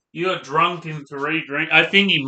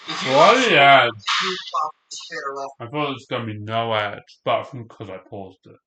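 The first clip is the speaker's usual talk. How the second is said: talking through a mouthful of food; chewing while speaking, words muffled; mouth full. Real, not acted. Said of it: "Sorry for talking with my mouth full".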